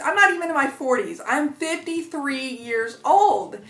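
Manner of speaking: ordinary delivery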